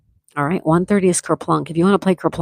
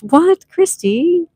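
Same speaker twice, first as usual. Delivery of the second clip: high, slow, amazed What, Christy?; a real gasp of surprise at the gift; surprised; 12 semitones higher, 5 dB louder, much slower than usual. Real, not acted. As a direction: stretch it out in disbelief. Reacts to ten memberships gift.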